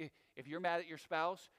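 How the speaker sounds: normal talking voice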